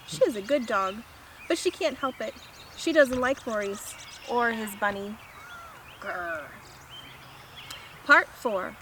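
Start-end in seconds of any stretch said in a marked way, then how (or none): 6.0-6.5 quiet, low grrr; low dog growl. Acted. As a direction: growl under the breath like a dog. Rosie growls at Maurice.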